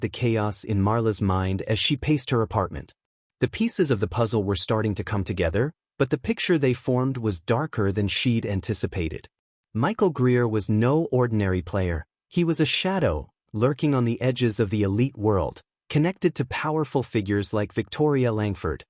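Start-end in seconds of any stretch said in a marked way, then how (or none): none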